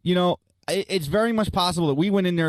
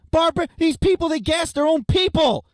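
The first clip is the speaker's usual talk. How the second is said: heated rant; 7 semitones higher than usual. Real, not acted. Ranting about barbarians.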